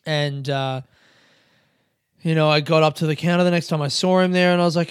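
ordinary talk, nothing marked

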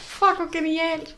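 high, drawn-out words through helpless laughter; laughing. Real, not acted. Co-host reacts to the Hagrid anecdote, laughing hard. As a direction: squeal it out while cracking up.

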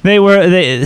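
normal talking voice

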